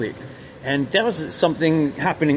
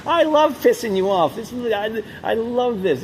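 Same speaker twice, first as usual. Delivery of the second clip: higher, gloating mock of a taunting woman; 7 semitones higher than usual. Quoting the Garden Club woman who taunted him, gleeful mock. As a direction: ham up her glee at annoying you. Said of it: "She says".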